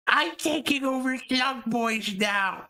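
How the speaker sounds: high, slow put-on character voice; imitated takeover. Streamer imagines a takeover announcement to the chat, high mock voice. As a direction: pitch it way up and stretch it out. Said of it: "just appeared and went".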